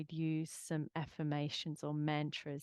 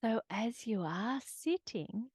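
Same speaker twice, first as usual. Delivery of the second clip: gentle guided grounding voice; calm grounding exercise; 2 semitones higher, 4 dB louder than usual. Acted.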